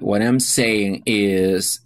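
slow, careful model phrase; drawn out for learners to copy; slow teacher demo of a clarifying phrase. Shown as a demonstration. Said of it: "For clarifying the meaning is".

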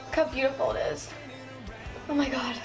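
ordinary talk, nothing marked

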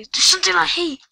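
high, loud outburst. Real, not acted. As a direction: blurt it out angrily. Frustrated outburst.

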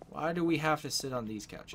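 usual speaking voice

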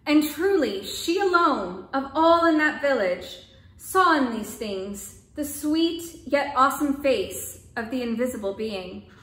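measured, expressive storybook reading; story narration. A narrator's reading. Storyteller reading a folk tale aloud, calm narration. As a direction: tell the tale gently to listeners.

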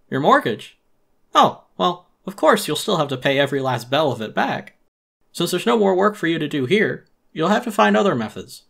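smooth-talk the firing politely; oily raccoon boss laying him off; Tom Nook voice. Acted. Nook continues.

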